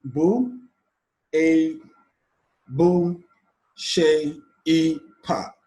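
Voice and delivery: detached syllables with pauses between. Shown as a demonstration. Teacher counting syllables back from the end, each said separately. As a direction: count off "bu, a, bu, she, e, pa" one at a time. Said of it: "the fourth syllable from the end".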